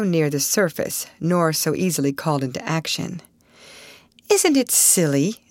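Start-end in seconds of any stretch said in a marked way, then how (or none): 4.3-5.4 sudden exclamation. Acted. Alice to the lost party, a sudden amused exclamation. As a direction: blurt it out, half amused. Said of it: "Alice suddenly exclaimed".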